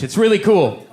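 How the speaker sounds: normal talking voice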